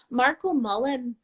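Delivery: a tentative go at an Irish accent; attempted Irish accent. Shown as a demonstration. Host greeting a viewer from Ireland, attempted Irish accent. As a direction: try the name with an Irish lilt. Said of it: "was that kind of an Irish accent".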